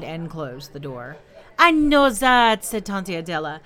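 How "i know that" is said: slow, loud, high retort; exasperated. A narrator's reading. Tante Adela answering Madame Ross, exasperated. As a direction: stretch it out with impatience.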